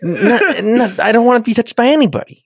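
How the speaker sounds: high-pitched retold protest; hammed-up upset, on the edge of laughter; mock anger. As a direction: imitate an indignant man insisting. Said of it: "He's very angry".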